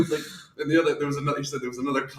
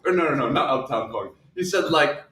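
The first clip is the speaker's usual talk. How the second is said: ordinary talk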